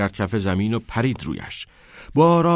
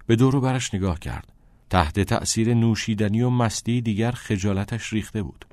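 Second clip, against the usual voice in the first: plain reading voice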